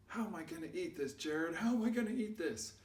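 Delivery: high, rising plaintive question; fretting. Retold or imitated. Nate asks Jared how to eat the bone, fretting and high. Friend frets over eating bone.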